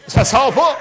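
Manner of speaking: loud, high, laughing give-up; laughing frustration. Retold or imitated. Telling the congregation it still would not come, loud laughing. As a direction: throw up your hands, laughing loud. Punchline of failed attempt.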